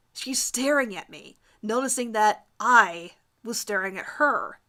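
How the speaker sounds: usual speaking voice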